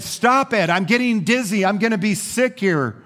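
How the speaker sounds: high, distressed pleading; dizzy protest. Acted. Clay spinning on the wheel to its master, pleading.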